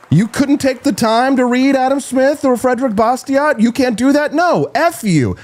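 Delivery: heated high-pitched rant. Real, not acted. Host ranting at the royal you about economics, heated and high. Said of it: "I'm not yelling at you".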